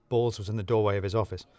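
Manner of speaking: ordinary delivery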